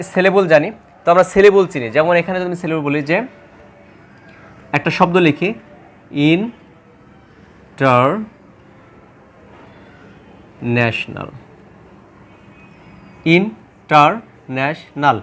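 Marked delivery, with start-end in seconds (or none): 6.3-15.2 slow, drawn-out international split into four syllables. Shown as a demonstration. Teacher showing exam students the four syllables, slowly drawn out. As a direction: stretch out each syllable of international. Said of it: "এখানে কিন্তু আমার চারটা syllable আছে".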